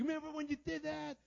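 sharp, high, loud accusing dig; accusing jab. Retold or imitated. Preacher mimicking himself needling his wife mid-argument, high and loud. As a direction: throw the old grudge back in their face. Bringing up old hurt in argument.

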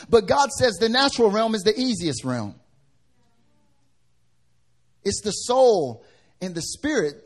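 loud, high, driving sermon voice; full-force preaching. Acted. Pastor preaching hard to the congregation, loud and high. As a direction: preach it out, hammering each line.

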